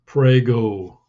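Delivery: put-on big bearded soldier calling after the boys, bellowing. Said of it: "bellowed the soldier after them".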